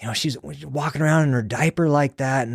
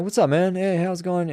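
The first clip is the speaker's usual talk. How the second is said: casual greeting; 6 semitones higher than usual. Retold or imitated. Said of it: "It's very normal".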